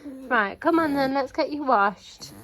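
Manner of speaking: normal talking voice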